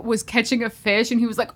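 ordinary talk

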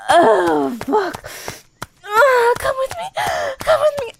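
high cries at the peak, near shouting; climax moans. Acted. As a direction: let go completely and cry out.

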